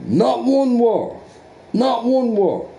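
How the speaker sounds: high, slow, stretched-out "Not one war"; emphatic rant. Real, not acted. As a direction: hammer it home with scorn. Ranting about America's wars.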